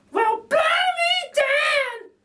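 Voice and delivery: very high, loud, slow shout; bowled over with surprise; Betty astonished. A narrator's reading. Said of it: "says Burglar Betty".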